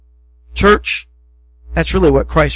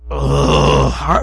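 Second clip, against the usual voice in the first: very low, exasperated "ugh"; frustrated groan. Retold or imitated. God at a forgetful people, imitated low exasperated groan. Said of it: "Can you understand his frustration".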